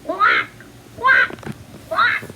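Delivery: high, squeaky quacks; quacking like a duck. Retold or imitated. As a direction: quack it like a duckling. Father duck imitates quacking.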